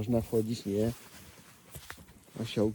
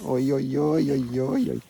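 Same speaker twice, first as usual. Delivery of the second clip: loud yelps; 4 semitones higher, 9 dB louder, slower than usual. Real, not acted. Donkey grabbing him.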